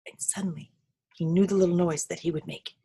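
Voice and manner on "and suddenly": whisper. A narrator's reading. Whispered suspense.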